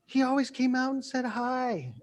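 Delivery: very high-pitched mock neighbor voice; baffled onlookers. Neighbors talking about the man who went postal, high mock disbelief. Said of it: "and the people are like".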